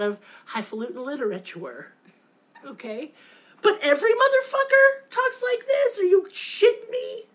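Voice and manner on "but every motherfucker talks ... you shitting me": higher and louder, outraged at the writing; indignant outburst. Real, not acted. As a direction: blow up in disbelief. Ranting about the show's dialogue.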